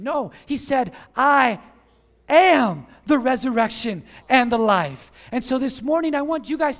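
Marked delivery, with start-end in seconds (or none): 1.1-5.1 loud "I", a pause, then loud "am"; emphatic declaration. Retold or imitated. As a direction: proclaim it with full weight on "I am". Preacher drives home Jesus' words.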